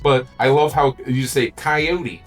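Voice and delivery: ordinary talk, nothing marked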